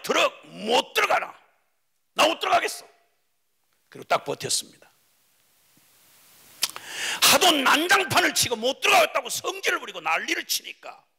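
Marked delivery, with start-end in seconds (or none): 0.0-2.8 분노한 형. Acted. 7.2-11.0 높고 큰 소리로 외치듯; 난장판을 고발하듯 힘주어 외쳐. Acted. 형의 난리를 외치며 전함.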